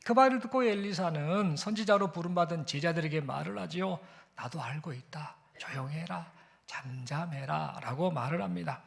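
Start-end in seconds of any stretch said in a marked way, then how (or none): 4.3-7.8 hushed whisper. Acted. Elisha hushing the disciples at Bethel, whispered. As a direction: hush them in a breathy whisper, no voice at all.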